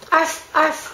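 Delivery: high, playful "arf, arf"; mock dog bark. Owner cueing the dog to bark in the song, mock bark. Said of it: "You do the arf, arf".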